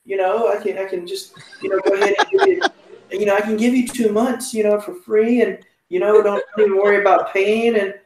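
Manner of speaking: lightly voiced apologetic phone agent; mimicking phone agent. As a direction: soothe him like a nervous phone rep. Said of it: "And she said".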